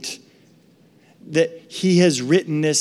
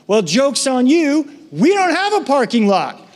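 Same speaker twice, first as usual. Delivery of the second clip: teasing punchline; 8 semitones higher, 4 dB louder than usual. Real, not acted.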